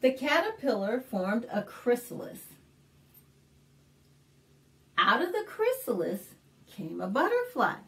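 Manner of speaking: put-on clear, lively read-aloud for little kids; story narration. Librarian reading a picture book to kids, lively storytelling.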